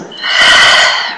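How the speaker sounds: long open-mouthed exhale; sigh out. Shown as a demonstration. Teacher showing the class a releasing breath, long audible sigh. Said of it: "then sigh out".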